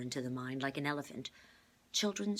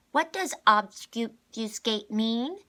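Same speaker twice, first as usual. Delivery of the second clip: put-on high, slow, loud child voice; a small girl drawing out a tricky word; little girl Constance; 8 semitones higher, 13 dB louder, much slower than usual. A narrator's reading.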